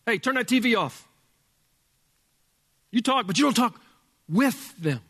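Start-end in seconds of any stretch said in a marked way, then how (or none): none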